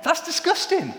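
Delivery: high-pitched, incredulous protest over the laughter; indignant disgust. Real, not acted. Mock protest as audience laughs.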